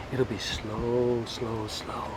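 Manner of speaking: slow, dropping to a hush; slow ominous murmur. Acted. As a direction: draw out each slow darkly. Ominous rambling warning.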